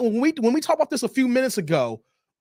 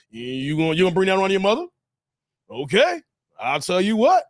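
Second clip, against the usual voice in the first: lightly voiced, lower-pitched dad; Bill Jordan impression; 4 semitones lower than usual. Dad questions son's choice of woman, lightly imitated. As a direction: imitate a father questioning his son. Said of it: "that's how Bill Jordan would talk".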